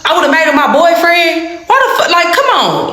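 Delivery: high-pitched outraged snap; heated retort climbing high; snapping back. Retold or imitated. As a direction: fire it back at the sister, incredulous. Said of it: "snapped up".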